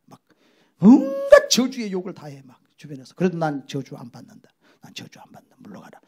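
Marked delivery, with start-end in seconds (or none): 3.1-6.0 soft, low, steady rebuke; quiet defiance. Acted. Believer quietly rejecting the curse. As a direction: hold firm under your breath.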